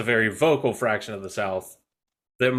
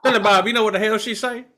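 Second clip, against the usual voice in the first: mock Southern accent; 8 semitones higher, 5 dB louder than usual. Mocking Southern accent talk.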